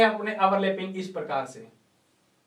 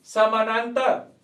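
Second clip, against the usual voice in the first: stretched syllable by syllable; slow dictation; 3 semitones higher, much slower than usual. Real, not acted. Teacher gives the class the next heading, slow dictation. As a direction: draw the word right out for note-takers.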